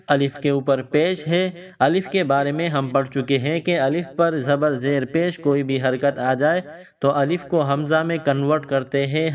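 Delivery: ordinary delivery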